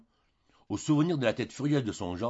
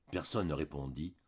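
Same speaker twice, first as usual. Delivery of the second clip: ordinary delivery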